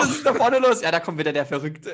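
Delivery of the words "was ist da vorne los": surprised. Pretend. Imagined shopper reacting.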